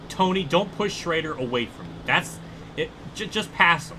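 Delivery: high, loud exasperated plea; pleading yell. Real, not acted. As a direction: beg the rival to just pass. Pleading with AI driver.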